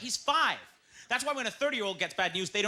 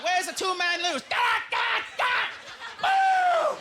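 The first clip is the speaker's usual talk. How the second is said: loud, high cheer; 13 semitones higher, 5 dB louder, much slower than usual. Acted. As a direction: whoop like a crowd. Mock Olympic cheering.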